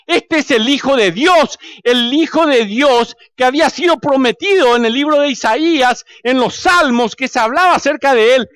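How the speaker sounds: high-pitched, forceful preaching; ringing pulpit declaration; impassioned proclamation. Acted. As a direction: declare it to the whole congregation, building in conviction. Preaching Bartimeo's proclamation at full force.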